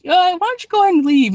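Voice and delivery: high, wary suggestion to go; mock-nervous officer; nervous cop. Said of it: "they're just like".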